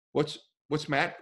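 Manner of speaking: ordinary talk, nothing marked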